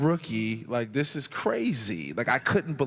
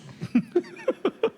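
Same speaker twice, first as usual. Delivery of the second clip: high burst of laughter. Real, not acted. Remembering KG's comeback, high burst of laughter.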